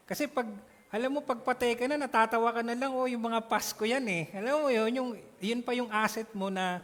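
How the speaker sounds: rising zeal to the congregation, loud and quick; excited preaching. Real, not acted. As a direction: build it up with fervour. Excited preaching about dead to self.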